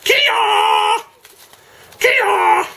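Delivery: sharp high kiai yell; karate shout. Acted. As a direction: shout it like chopping a board. Splitting the wax block in half.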